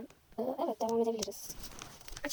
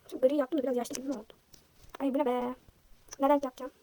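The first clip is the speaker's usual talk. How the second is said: ordinary talk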